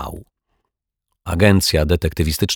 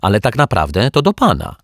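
shouting back; 5 semitones higher, 4 dB louder, faster than usual. A narrator's reading. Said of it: "odkrzyknęła pani Ryczaj".

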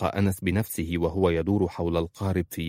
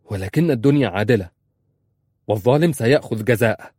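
louder, higher angry protest; 4 semitones higher, 6 dB louder than usual. Acted. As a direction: fire it back in anger. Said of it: "في غضب".

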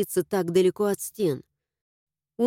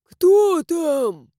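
put-on louder, creaky old voice; creaky old man; 7 semitones higher, 7 dB louder than usual. A narrator's reading. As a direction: repeat it like a stubborn doorman. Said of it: "произнёс тот же голос".

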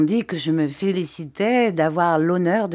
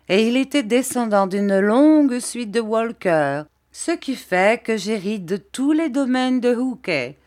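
higher, lightly voiced boastful little woman; Miss Mowcher; 5 semitones higher, 4 dB louder than usual. A narrator's reading. Little woman boasting of her long family line, lightly acted grandeur. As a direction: lift the pitch and stretch the boast.

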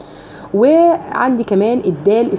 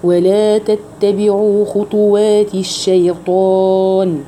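slow, drawn-out melodic tajweed recitation; Quran recitation; much slower than usual. Shown as a demonstration. Teacher recites the verse for her students, slow melodic chant. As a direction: chant each verse slowly and evenly.

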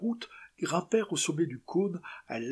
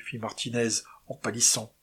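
ordinary delivery, nothing marked

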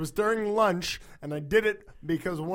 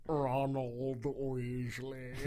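slow, low, drawn-out drawl; hushed mock-stern character voice; Snape; 5 semitones lower, 8 dB quieter, much slower than usual. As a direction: stretch the name out low and slow. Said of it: "like, you know the face".

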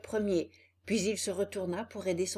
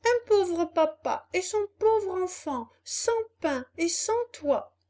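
high, entreating call at a door; acted needy child's plea; pitiful appeal; 10 semitones higher, 6 dB louder than usual. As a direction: plead poverty to an unseen host. Puppet begs at a door.

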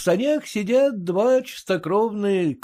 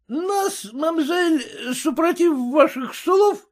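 loud, high tipsy bluster; drunk merchant; 12 semitones higher, 5 dB louder than usual. A narrator's reading.

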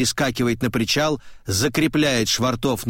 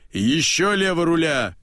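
higher called order; shout; 6 semitones higher than usual. A narrator's reading. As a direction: shout the steering order across the boat. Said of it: "кричит ослик". (крик рулевой команды)

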